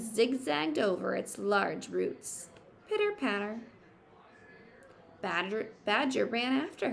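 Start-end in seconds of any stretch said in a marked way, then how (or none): 2.9-3.6 light, bouncy sound word; pattering footsteps. Acted. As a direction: tap it out like little feet. Sound word in picture book.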